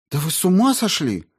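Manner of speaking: high, loud outraged cry; outraged outburst. A narrator's reading. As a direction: burst out in disbelief. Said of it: "Маргарита вскочила".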